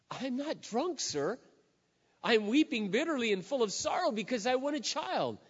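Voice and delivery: pleading woman. Retold or imitated. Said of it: "and she's crying".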